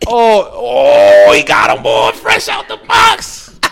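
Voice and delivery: very high, loud hooting yell; mock excited hollering. Onlookers spotting new Timbs on him, mock excited hollering. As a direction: holler it out like a hyped-up heckler.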